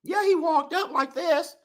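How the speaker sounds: lightly voiced old man walk-up; a hint of a doddering old man; old man shuffling up. Husband imitating the old man at bingo, lightly voiced. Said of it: "I'm gonna imitate".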